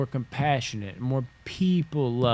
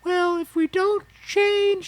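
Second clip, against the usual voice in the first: much higher, slow mocking whine; exaggerated sensitive voice; 5 dB louder, slower than usual. Caricaturing the sensitive side in a high mock whine. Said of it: "I'm over-exaggerating".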